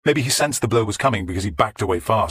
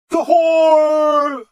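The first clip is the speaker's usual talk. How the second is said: a madman's drawn-out howl; throbbing howl; 8 dB louder than usual. Acted. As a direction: draw the word out into a wail.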